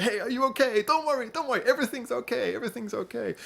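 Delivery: high, soothing put-on translator voice; calm him down in a high foreign voice; reassuring translator. Translator soothing the scared detainee, high reassuring voice.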